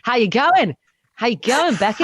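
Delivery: jokey put-on Aussie greeting; Australian accent. Imitating a friend back from Australia, Australian accent. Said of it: "start talking in an Australian accent".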